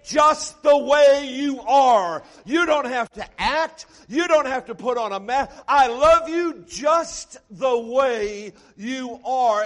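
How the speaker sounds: loud, impassioned cry of love; bridegroom's love, proclaimed at full shout; shouted loving declaration. Acted. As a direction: shout it out like a preacher voicing the groom. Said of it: "unto her and say".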